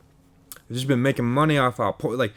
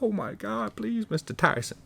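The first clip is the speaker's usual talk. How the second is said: high, softer mock begging; mock pleading; 9 semitones higher, 4 dB quieter than usual. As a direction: plead in a put-on higher voice. Acted begging of Tyson.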